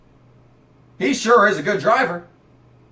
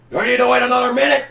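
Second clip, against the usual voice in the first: ordinary talk, nothing marked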